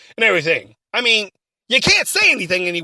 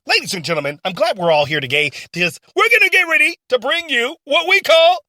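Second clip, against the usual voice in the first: usual speaking voice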